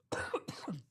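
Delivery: harsh sudden cough; coughing fit. Real, not acted. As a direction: cough hard, clear the throat. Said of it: "Sorry about that".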